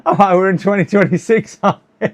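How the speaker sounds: amused laugh at his own mistake. Real, not acted. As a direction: giggle out the realisation. Laughs at the wrong year.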